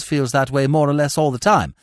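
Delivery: ordinary delivery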